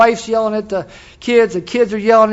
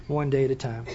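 soft echo; 5 semitones lower, 10 dB quieter than usual. Shown as a demonstration.

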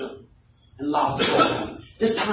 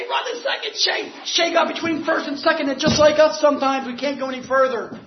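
excited storytelling; 10 semitones higher than usual. Real, not acted. Preacher telling the meeting about the final play, excited and high. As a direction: tell the finish like a live game call. Builds the game's climax excitedly.

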